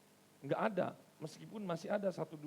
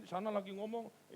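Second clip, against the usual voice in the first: ordinary delivery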